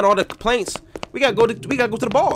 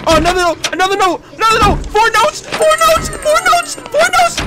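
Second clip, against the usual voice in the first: getting more thrilled with every note found; excited, building; 6 dB louder than usual. Real, not acted. Gamer finding notes in the store, climbing excited shout. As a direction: voice climbs higher and higher with each note, loud.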